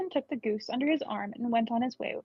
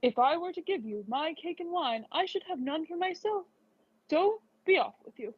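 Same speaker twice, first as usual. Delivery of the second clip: scornful refusal; 4 semitones higher than usual. Acted. Eldest son refuses the little gray man, sneering and dismissive. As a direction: brush the beggar off with contempt.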